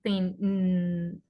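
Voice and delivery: "Ten, mm" trailing into a hum; held nasal ending. Shown as a demonstration. Teacher showing a sound like ñ, sustained nasal hum. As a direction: hold the final nasal of "Ten, mm". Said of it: "un sonido similar a la ñ".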